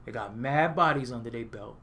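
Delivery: ordinary talk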